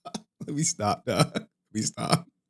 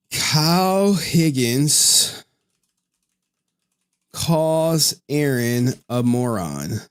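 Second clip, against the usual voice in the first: very slow, spaced headline; slow dramatic reveal; 2 semitones higher, much slower than usual. Acted. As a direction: spell out each word like a teaser.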